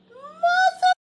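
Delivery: sudden high crying scream. Retold or imitated. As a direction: shriek it out in tears. Furious scream at boyfriend hanging up.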